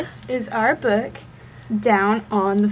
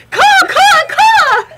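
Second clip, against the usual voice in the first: high loud cawing crow; 12 dB louder than usual. Acted. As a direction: squawk like a crow. Animal sound in kids' book.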